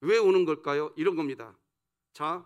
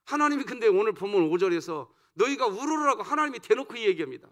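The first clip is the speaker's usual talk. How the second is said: ordinary delivery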